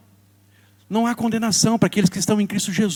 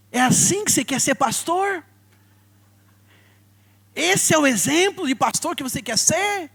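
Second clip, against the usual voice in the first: higher, reproachful mother's voice; mother's cutting rebuke; 8 semitones higher than usual. Retold or imitated. Mother scolding her son about being a pastor, higher and reproachful. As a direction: throw his calling back at him like a disappointed mother. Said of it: "daí ela falou assim".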